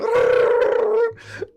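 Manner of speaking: high-pitched mock gibbering noise; silly high noise mimicking a ranter; imitated wind-up babble. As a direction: go off like a wound-up toy. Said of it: "help me just go".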